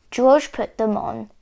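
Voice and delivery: usual speaking voice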